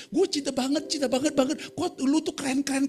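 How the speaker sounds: high-pitched mock youth slang; teasing imitation of Gen Z talk; gushing youngster. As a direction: gush like a lovestruck young person. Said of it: "kayak anak muda zaman sekarang".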